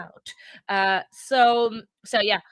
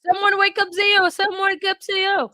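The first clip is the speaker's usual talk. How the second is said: higher, louder call across the house; 10 semitones higher, 7 dB louder than usual. Real, not acted. To the house, calling to wake Theo for the news. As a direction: yell for someone to rouse Theo.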